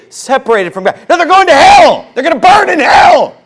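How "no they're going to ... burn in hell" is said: much higher and louder, a full-force preaching shout; fiery shout from the pulpit. Real, not acted. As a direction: blast it at the congregation. Preacher rejects euphemism, shouting.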